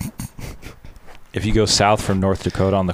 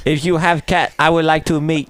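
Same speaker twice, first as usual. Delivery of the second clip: joking imitation Chinese accent, pitched up; 5 semitones higher than usual. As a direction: play along with a mock Chinese accent. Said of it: "Chinese accent".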